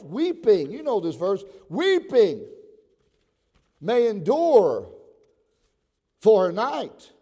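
high, loud pulpit shout; impassioned shouted sermon with drawn-out key words; full-force preaching. Real, not acted. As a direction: thunder it out to the whole church.

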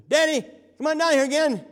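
put-on high, loud boy's shout for daddy; child calling out. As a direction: yell for dad from down the shop. Boy calling his dad down.

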